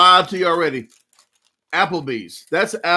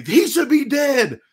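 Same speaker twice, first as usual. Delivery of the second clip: put-on high, slow child's indignation; a little kid outraged at a cartoon; pissed-off kid; 10 semitones higher than usual. Young boy watching Bugs Bunny, high and slow with outrage. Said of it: "I used to get pissed and say".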